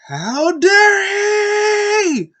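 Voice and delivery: very high, slow, drawn-out outraged cry; furious shout. Retold or imitated. Roman outraged at Owens, drawn-out shrieking shout. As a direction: scream it in outrage. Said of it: "he was like".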